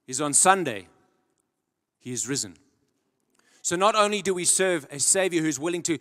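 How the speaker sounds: usual speaking voice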